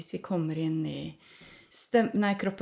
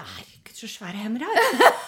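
amazed inner thought; 4 semitones higher, 7 dB louder than usual. Retold or imitated. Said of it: "så på hendene mine og tenkte".

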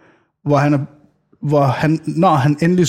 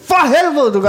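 instinctive shout; 11 semitones higher than usual. Retold or imitated. Father yelling at toddler about to fall, alarmed shout. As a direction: blurt it out on instinct, pitched way up. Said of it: "det er helt instinktivt".

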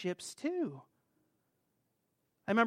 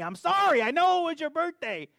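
pleading apology; 9 semitones higher, 6 dB louder than usual. Retold or imitated. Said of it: "I call her, I'm like".

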